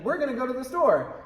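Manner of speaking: overdone stagey announcement; announcing. Shown as a demonstration. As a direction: proclaim it like a big announcement. Repeats the announcing example.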